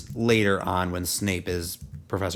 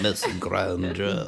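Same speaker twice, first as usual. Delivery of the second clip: slow, softer, lower drawl of the name; Snape impression; 6 semitones lower, 6 dB quieter, much slower than usual. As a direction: stretch the name out slowly like Snape. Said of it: "me doing Allie doing Snape".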